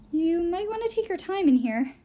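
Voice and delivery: a jumpy housekeeper's heads-up; warning maid. Acted. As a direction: caution them, glancing at the door. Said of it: "Inge warned us".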